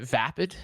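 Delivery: "vapid" said as VAP-id; "vapid" with a short first vowel, not like vape; short-A pronunciation. Shown as a demonstration. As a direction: say "vapid" with the A of cat. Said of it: "bringing over the vape pronunciation into that word".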